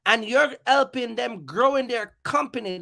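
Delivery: ordinary talk, nothing marked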